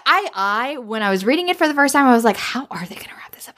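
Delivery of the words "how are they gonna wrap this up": whispered worried aside. Retold or imitated. Reader recalls fretting over the plot, whispered. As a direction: hush it like a secret fear. Reader's inner worry retold.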